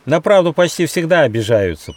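high, loud, touchy grumble; grumbling. A narrator's reading. Squirrel defends his bluntness to the gnome, grumbling. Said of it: "проворчал Бельчонок".

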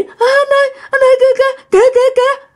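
very high, terrified repeated cries; frightened crying. Retold or imitated. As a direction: imitate a panicked wife urgently pointing something out. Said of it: "vợ bạn sợ hãi mà đang khóc".

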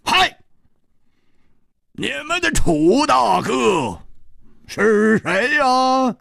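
high, drawn-out challenge shout; big man's booming-out question, pitched high; loud demand. A narrator's reading. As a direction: call out slowly at a high pitch. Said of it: "大声说".

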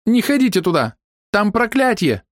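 high, urgent female warning; high-pitched cry of alarm; high-voiced warning woman. A narrator's reading. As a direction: warn them off in high voice. Hidden woman warns of curse.

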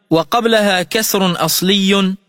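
ordinary talk, nothing marked

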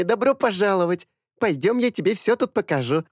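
high happy chatter; bubbly welcome. A narrator's reading. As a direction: genuine bright welcome. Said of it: "радостно затараторила". (радостное приветствие)

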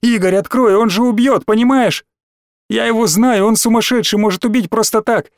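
put-on high, panicked child's voice; urgent cry. A narrator's reading. Small boy cries through door for his friend, urgent. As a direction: plead urgently, pitched well up. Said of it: "мелкий совсем рядом с дверью крикнул".

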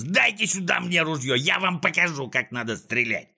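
high, bossy demand dropping at the end; snatch control with annoyed orders; exasperated villain voice; irritated yell. A narrator's reading. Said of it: "заорал с раздражением Клопс".

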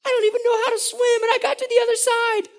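very high, whooping celebration; excited. Acted. Freed Israelites on the far shore, high excited cheering. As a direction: crow in triumph with stretched high shouts. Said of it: "they're excited about what's going on".

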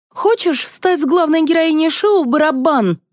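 high-pitched, pushy pitch; pouncing. A narrator's reading. Liza recruiting Valentina for the show, pushy. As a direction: pounce on her with an urgent offer. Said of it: "налетела на неё Лиза".

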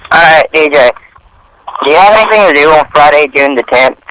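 tinny speakerphone voice; phone speaker. Real, not acted. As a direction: talk through a phone on speaker. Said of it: "you're on speaker".

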